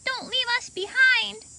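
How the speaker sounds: high pleading puppet voice; dinosaur puppet voice. Pretend. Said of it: "Emerson made her dinosaur puppet say".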